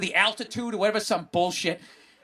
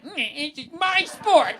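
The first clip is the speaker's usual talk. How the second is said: mock upset; 7 semitones higher, much slower than usual. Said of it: "he's, like, upset".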